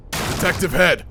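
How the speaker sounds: high, loud hail; urgent call. Acted. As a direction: burst in calling out with urgency. Bursts in calling the detective.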